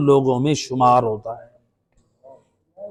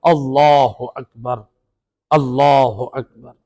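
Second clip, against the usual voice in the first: slow and deliberate; 3 semitones lower, much slower than usual. Retold or imitated. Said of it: "ایک ایک لفظ کو جدا جدا پڑھ رہے تھے".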